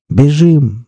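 mild, high-pitched urgent call; crying out. A narrator's reading. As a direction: call out to flee, not at full volume. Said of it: "закричал Пипец".

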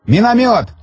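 high sharp warning shout; yelling. A narrator's reading. Soldier warning of a mortar, shouting. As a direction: yell the alarm across the field. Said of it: "заорали в стороне".

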